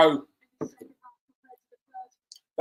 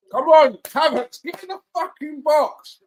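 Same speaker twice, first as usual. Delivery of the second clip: loud urging; 10 semitones higher, 6 dB louder than usual. Real, not acted.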